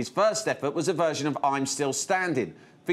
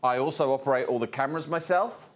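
normal talking voice

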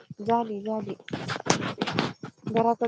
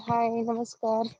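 ordinary delivery

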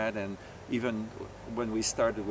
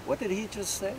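higher-pitched, puzzled aside; mock disbelief; 8 semitones higher, 3 dB quieter than usual. Bandleader recalling reaction to a job offer, higher mock disbelief. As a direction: act out a stunned double take.

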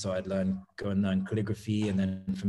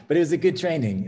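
laughing through the words, a little higher and louder; 8 semitones higher, 4 dB louder, faster than usual. Real, not acted. Guest laughs while telling the host about childhood woodwork. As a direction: chuckle it out, amused at the memory.

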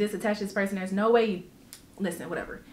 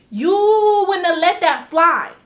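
heated rant; 6 semitones higher, 6 dB louder, slower than usual. Real, not acted.

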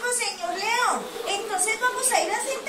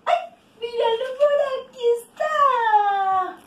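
high, playful call of discovery; excited discovery; 5 semitones higher, 4 dB louder than usual. Pretend. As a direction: call out high that you found it. Finds the lost pig. (grito agudo y emocionado de hallazgo)

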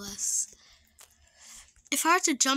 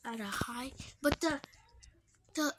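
ordinary talk

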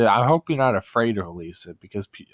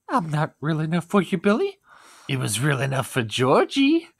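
higher, taunting clown voice; Pennywise voice; 7 semitones higher than usual. Pretend. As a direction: jeer at Billy in clown voice. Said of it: "my Pennywise voice impression".

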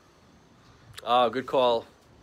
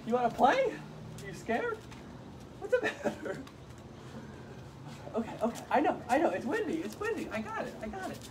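high, sing-song cooing at a pet. Real, not acted. Owner teasing his dog in high baby talk.

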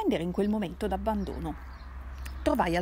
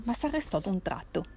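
ordinary delivery, nothing marked

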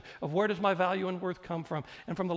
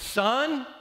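high, loud scolding mother voice; 4 semitones higher, 4 dB louder than usual. Retold or imitated. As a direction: play the mother who scolds her son after three days of searching. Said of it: "And it goes something like this".

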